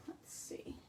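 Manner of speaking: a breathy whisper to herself; quietly mulling it over under her breath; whispered aside. Real, not acted. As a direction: think aloud in a whisper. Muttering to herself while working.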